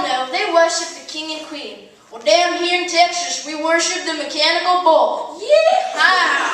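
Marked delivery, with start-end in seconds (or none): 5.4-6.5 loud laughing yeehaw; cowboy yell. Pretend. Comic boasting of his Texas birth, loud yeehaw. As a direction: whoop it out like a rodeo cowboy.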